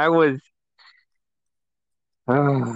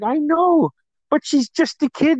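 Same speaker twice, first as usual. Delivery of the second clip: higher, lightly voiced amused mom; a hint of Mom placating him, pitched up; imitating Mom; 12 semitones higher than usual. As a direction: brother imitating Mom defending his sister, higher voice. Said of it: "She's like".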